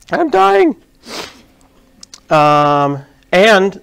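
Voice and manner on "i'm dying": very high, held mock wail; hammed-up high-pitched cry; mock dying cry. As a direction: squeal it in mock agony. Jokes about sneezing with mic live.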